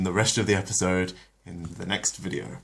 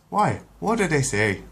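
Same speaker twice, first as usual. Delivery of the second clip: higher, lightly Irish puzzlement; confused doctor; 8 semitones higher, 4 dB louder than usual. Acted. The Irish-accented doctor asks Brian, lightly puzzled. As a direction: ask innocently, clueless.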